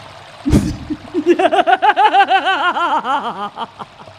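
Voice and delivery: evil laugh. Acted. Moriarty gloating over trapped Holmes, evil cackle. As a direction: laugh in triumph as you leave them to drown.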